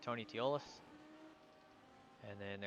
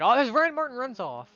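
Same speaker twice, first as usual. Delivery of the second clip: sudden high, loud commentator shout; real excited yell; shocked race call; 12 semitones higher, 15 dB louder than usual. Real, not acted. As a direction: burst out as the car leaves the track. Sudden crash in live commentary.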